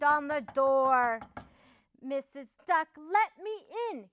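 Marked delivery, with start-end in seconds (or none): 2.0-4.1 high, insistent storybook howl; howled plea. A narrator's reading. Upset wolf banging on the duck's door, mildly howling. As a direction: demand to be let in, lightly howled. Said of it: "he howled".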